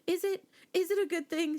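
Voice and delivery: freaked-out wife questioning husband, high and anxious; anxious doubt. Retold or imitated. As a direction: ask it with rising panic, voice jumping up.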